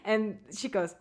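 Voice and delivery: ordinary talk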